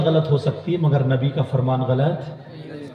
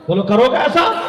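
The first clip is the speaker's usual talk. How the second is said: higher, rising rallying question; raised preacher's challenge, climbing at the end; insistent demand; 10 semitones higher, 3 dB louder than usual. Real, not acted. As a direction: press the crowd loudly for an answer. Insistent repeat to the crowd.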